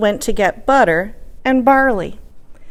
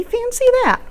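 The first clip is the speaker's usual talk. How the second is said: put-on much higher, amazed little old lady. Old lady delighted by the soup, high and amazed. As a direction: say it with wide-eyed wonder.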